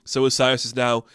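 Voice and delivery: normal talking voice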